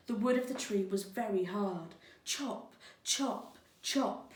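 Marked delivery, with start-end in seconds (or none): 2.2-4.3 high, punchy chop sounds like axe blows; chopping sounds. A narrator's reading. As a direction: strike each word like an axe.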